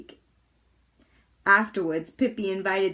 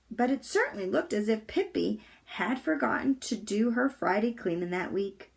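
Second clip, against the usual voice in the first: ordinary delivery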